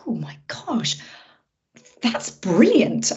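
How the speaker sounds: hushed, breathy retold amazement; drawn-out, swooping mock-whispered wonder; awed reaction. Director recalling seeing the actress's self-tape, awed reaction.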